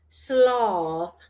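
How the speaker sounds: "sloth" with a long British aw vowel; like David Attenborough. Shown as a demonstration. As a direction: drawl "sloth" like a nature documentary narrator. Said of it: "saying sloth like David Attenborough".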